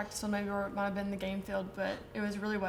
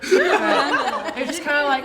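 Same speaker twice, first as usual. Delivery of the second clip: slow, higher, laughing through the words; can't stop laughing as you speak; 6 semitones higher, 11 dB louder, much slower than usual. Real, not acted. Laughing at teammate's story.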